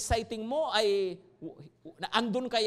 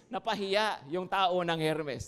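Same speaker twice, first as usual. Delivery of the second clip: ordinary delivery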